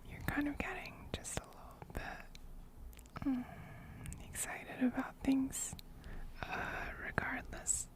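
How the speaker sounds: soft ASMR whisper close to the mic; ear whispering. Real, not acted. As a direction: breathe it right into their ear. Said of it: "somebody else redeemed whispering".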